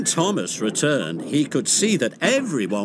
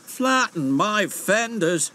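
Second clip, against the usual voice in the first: shouting; 7 semitones higher, much slower than usual. A narrator's reading. Monty shouting as he crashes. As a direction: cry out as the crash hits. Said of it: "shouted Monty".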